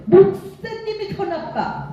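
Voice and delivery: high-pitched, drawn-out mock yell; retold yell. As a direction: imitate yourself yelling an accusation. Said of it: "소리를 빽 질렀어요".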